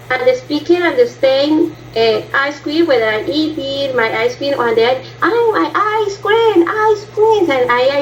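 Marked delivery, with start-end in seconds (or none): 5.2-7.5 much higher mimicking voice; high mimic. Student mimics the girl answering about ice cream, high pitched. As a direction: squeak it up to copy her. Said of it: "I making the, the mimic".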